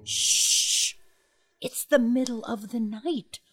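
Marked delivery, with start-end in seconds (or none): 0.0-1.0 hushing. Acted. Hushing the giant at night.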